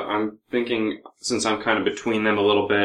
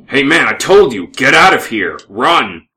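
loud, raised-pitch urgent order; urgent warning; 4 semitones higher, 11 dB louder than usual. Acted. Player trying to persuade the dwarf to flee, urgent warning. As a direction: drive them away with a forceful shout.

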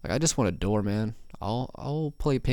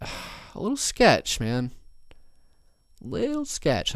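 much higher, slow sighing voice; odd sudden voice; 4 semitones higher, slower than usual. Real, not acted. Podcast host doubting a flask tie website, in a sudden much higher voice. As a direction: pitch it way up and drag it out after a sigh. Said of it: "why I'm talking like this all of a sudden".

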